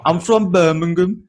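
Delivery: slow, loud mock Brummie; Birmingham accent. Mocking the accent he ranks among the worst, broad Birmingham accent. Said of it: "say the Birmingham accent".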